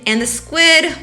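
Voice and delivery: usual speaking voice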